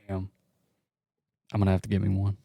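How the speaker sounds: ordinary talk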